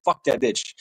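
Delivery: ordinary delivery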